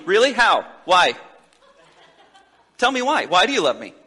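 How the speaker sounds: playful, half-teasing demanding questions; hammed-up doubting partner asking why; lightly acted suspicious spouse; mock needy spouse.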